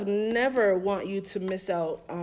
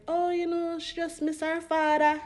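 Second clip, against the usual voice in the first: friend's mom explaining; 9 semitones higher than usual. Retold or imitated. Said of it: "she was like".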